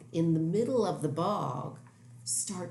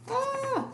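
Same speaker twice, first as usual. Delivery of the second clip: mock scream. Bugs flying out of mouth.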